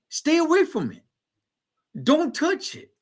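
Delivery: high-pitched panicky warning, played for mockery; hammed-up alarm. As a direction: imitate alarmed people in a squeaky-high voice. Mocks the others' panicked warnings.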